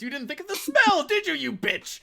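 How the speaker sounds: high, loud mocking sneer, quick; imitating Dennis. Quoting Dennis's smell line, high mocking sneer. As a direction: sneer it like Dennis from It's Always Sunny. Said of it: "Dennis just says".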